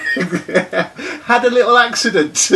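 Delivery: laughing through the words; giggling while telling it. Real, not acted. Laughing while telling injury story.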